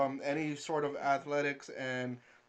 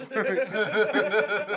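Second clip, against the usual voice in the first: laughing; 6 semitones higher, 8 dB louder than usual. Real, not acted. Laughing punchline of retold story.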